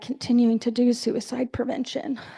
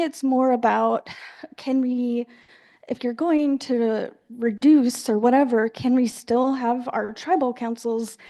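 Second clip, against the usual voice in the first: ordinary talk, nothing marked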